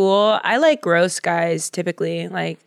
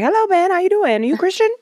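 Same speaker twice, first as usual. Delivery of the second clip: flirty greeting; 11 semitones higher, faster than usual. Retold or imitated. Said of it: "I'd be like".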